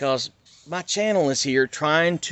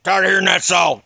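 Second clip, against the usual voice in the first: dad yelling, louder on the repeat; imitated angry shout; 6 semitones higher, 7 dB louder than usual. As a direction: bark it at him like an angry father. Dad angrily repeats himself.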